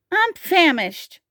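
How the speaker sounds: high, slow hungry complaint; high-pitched storybook grumble; grumbling. A narrator's reading. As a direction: grumble like a hungry little dinosaur. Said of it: "grumbled Tiny".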